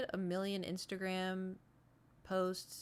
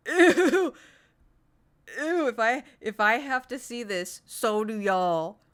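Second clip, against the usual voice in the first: loud, high disgusted squeal; grossed out, shrieking; 4 semitones higher, 12 dB louder, slower than usual. Real, not acted. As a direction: recoil in disgust, loudly. Disgusted at meme.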